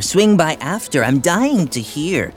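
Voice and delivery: slow, mock-eager drawl; sarcastic. Friend teasing Archie about his dinner date, sarcastic. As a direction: feign great interest, stretching the words. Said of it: "he said sarcastically".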